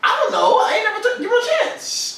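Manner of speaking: squeaky laughing denial; laughing high protest. Real, not acted.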